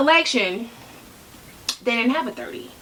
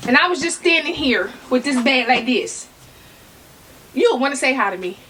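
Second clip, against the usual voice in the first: normal talking voice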